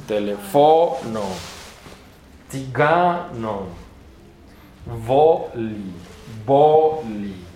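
slow words, next-to-last syllable stretched; penultimate stress. Shown as a demonstration. Teacher demonstrating the accent rule, slow stressed penultimate syllables. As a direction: lean long on the second-last syllable. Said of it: "Con pronunciación grave".

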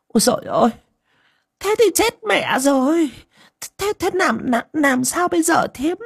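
a mother losing her nerve; panicked mother. A narrator's reading. Mother Ngà begging the aunt what to do, panicked.

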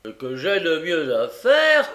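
exclamation plus forte; s'écrier, indigné. A narrator's reading. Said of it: "s'écria Noé". (Noé s'écrie, indigné, plus fort)

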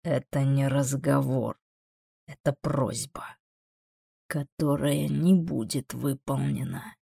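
slow, cold refusal in demon voice; measured, implacable demon; unruffled demon. Acted. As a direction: refuse slowly and quietly, unmoved. Voices the demon Amon in dialogue.

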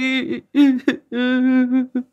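drawn-out, high-pitched wailing sobs; acted weeping; long high sobbing. A narrator's reading. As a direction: trail into stuttering sobs. Mother's plea ends in weeping.

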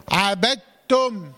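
slow عبدتم said "abattum", doubled t and no d; assimilated dal. Shown as a demonstration. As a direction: recite عبدتم, closing firmly on a held double t. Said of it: "هكذا".

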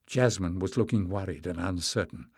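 ordinary delivery, nothing marked

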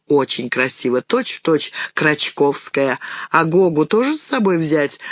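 plain reading voice

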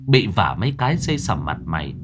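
ordinary delivery, nothing marked

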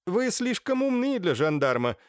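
high-pitched mocking dig; barbed jibe. A narrator's reading. Naledinsky needles the gendarme, barbed mockery. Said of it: "отпустил шпильку Налединский".

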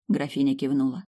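plain reading voice

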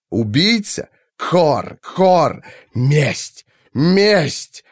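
high, chanting creature cries, rising on the last; thunderous enemy. A narrator's reading. The unseen enemy answers Kaifat's mental question with vengeful cries. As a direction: cry it like a vengeful bird-beast. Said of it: "Раскатами грома прогрохотал ответ".

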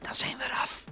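whisper. Acted. Keeping quiet near creatures.

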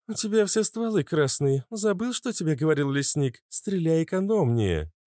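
high-pitched character voice giving a reprimand; high, admonishing heroine; Иштар, chiding. A narrator's reading. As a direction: scold a comrade in a high voice.

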